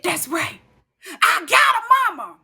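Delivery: high, wailing retort to mother; mock tearful. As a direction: cry it out loud, replaying being upset. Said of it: "I called my mama so upset".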